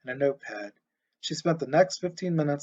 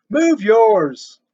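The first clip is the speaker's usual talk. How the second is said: much louder, higher, yelling back; shouted retort; 7 semitones higher, 11 dB louder than usual. A narrator's reading. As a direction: fire the words back. Shouting match with neighbor.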